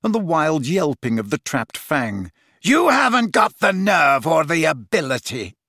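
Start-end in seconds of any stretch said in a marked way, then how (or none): 2.6-5.6 scornful, projected jeer; shouted taunt. A narrator's reading. Snape taunting Harry mid-duel, scornful shout. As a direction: throw it at him with contempt.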